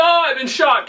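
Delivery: mock wounded cry. Robber hit in the shoulder.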